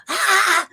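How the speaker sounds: high, loud frustrated groan at the traffic. Retold or imitated. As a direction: let out an exasperated cry behind the truck. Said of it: "huffing and puffing and arms flailing".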